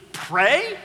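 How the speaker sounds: mock disbelief building to a loud cry; mock incredulous. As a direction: scoff at the very idea of prayer. Mock incredulous busy person.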